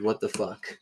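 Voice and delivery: normal talking voice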